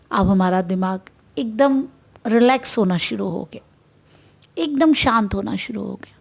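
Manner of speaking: soothing breathing guide; guided meditation. Acted. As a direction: ease the listener into stillness, one breath at a time.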